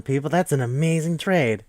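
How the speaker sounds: normal talking voice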